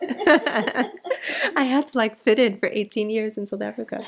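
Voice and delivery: laughing through the words; giggling while explaining. Real, not acted. Laughing about her accent.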